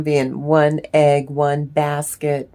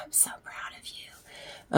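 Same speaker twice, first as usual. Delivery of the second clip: whisper; 15 dB quieter, slower than usual. Real, not acted. Whispered praise to viewer.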